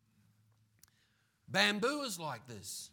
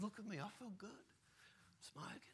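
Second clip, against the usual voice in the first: softer, half-whispered boast; smug young smoker; 4 semitones higher, 13 dB quieter than usual. Retold or imitated. Preacher voicing the fit young vaper, quietly smug. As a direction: gloat quietly to yourself. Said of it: "voicing the young smoker".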